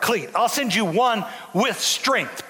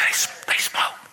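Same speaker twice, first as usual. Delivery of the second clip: gossiping whisper. Retold or imitated. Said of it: "Why don't we let them, oh".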